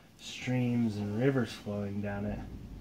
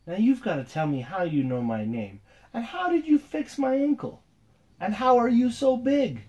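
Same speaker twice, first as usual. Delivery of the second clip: higher and louder, rising to a shout; excited boy demanding; 11 semitones higher, 6 dB louder than usual. Acted. Zavi to the giant girl, higher and louder demanding. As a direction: press her for answers, getting louder. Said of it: "looks at her".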